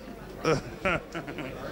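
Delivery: a soft laugh at his own joke; brief amused laugh; quiet chuckle. Real, not acted.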